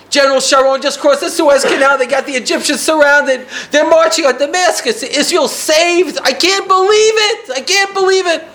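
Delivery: high, loud, tearful disbelief; weeping with joy, voice high and straining; Walter's emotional outburst. Retold or imitated. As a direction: cry it out in joyful shock, high and loud. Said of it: "he's crying".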